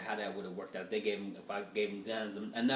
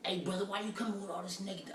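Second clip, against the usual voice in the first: higher mock scolding brother; upset Facebook guy; 8 semitones higher than usual. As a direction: play the preachy offended dude.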